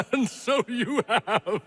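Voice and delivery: high laugh into slow gloating; laugh, then gloat slowly; mocking laugh. Villain mocks captured hero.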